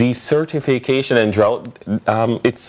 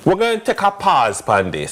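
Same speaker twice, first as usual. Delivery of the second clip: mock casual phrasing; 8 semitones higher than usual. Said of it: "you don't say".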